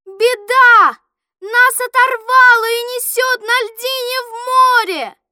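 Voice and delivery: loud, high cry of disaster; urgent high-pitched yell; alarmed shout. A narrator's reading. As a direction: shout the terrible news to everyone. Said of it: "А отец крикнул".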